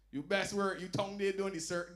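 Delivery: normal talking voice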